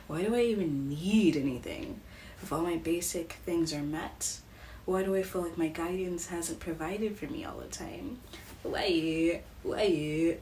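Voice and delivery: a run of soft, pleading whys, like a reading; hushed channeled questions. Acted. Psychic voicing the viewer's inner questions, quietly. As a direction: ask each why quietly, channeling the viewer's doubts.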